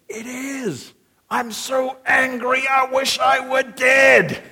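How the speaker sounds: put-on high, slow, shouting two-year-old; toddler tantrum [name]. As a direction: wail it like a sulking toddler. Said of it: "The role of a two-year-old will now be played by [name]".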